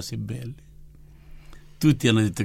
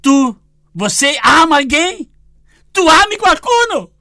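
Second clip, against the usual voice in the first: God calling again; 11 dB louder than usual. Retold or imitated. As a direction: press the question from above, high and loud. The Lord calls again.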